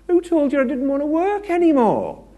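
wife firing back in disbelief; indignant wife. Retold or imitated. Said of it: "And she says".

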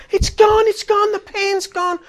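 high, loud, overwhelmed cries; imitated crying outburst. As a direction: act out her tearful relief, wailing. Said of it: "and burst out crying".

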